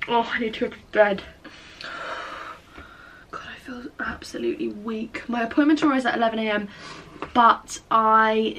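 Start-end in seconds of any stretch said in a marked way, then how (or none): 3.3-5.2 exhausted, breathy whisper to the camera; weak whisper. Real, not acted. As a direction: whisper it, completely drained. Tired late at night, exhausted.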